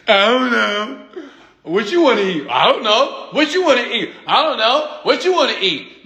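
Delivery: high, hesitant voices for the uncertain pair; mock indecisive couple. Mocking a couple who can't pick where to eat, high and unsure.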